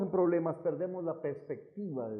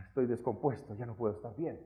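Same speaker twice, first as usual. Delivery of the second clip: low, soft, defeated moan; mock despair; 6 semitones lower, 8 dB quieter than usual. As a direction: act out giving up, voice sunk and hushed. Acts out people giving up after quarrel.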